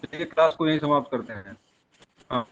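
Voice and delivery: ordinary talk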